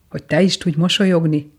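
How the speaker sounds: ordinary delivery, nothing marked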